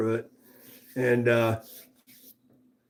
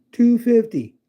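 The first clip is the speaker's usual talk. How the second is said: Two fifty said higher and louder, stressed on two; excited repeat; 9 semitones higher, 5 dB louder than usual. Shown as a demonstration. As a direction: punch out the price in disbelief.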